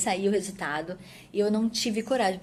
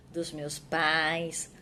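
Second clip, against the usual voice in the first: ordinary delivery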